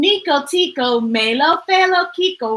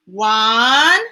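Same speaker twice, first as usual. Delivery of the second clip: stern; 4 dB louder than usual. A narrator's reading. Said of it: "Papa said sternly".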